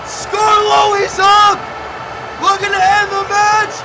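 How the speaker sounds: loud, high excited match call; live play-by-play. Acted. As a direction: scream the big spots to the crowd.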